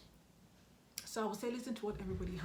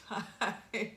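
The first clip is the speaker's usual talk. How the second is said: said through a real laugh, louder; 3 semitones higher, 5 dB louder than usual. Real, not acted. Admitting to viewers what takes up time, laughing. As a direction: break into laughter on the word. Breaks into laughter finishing the sentence.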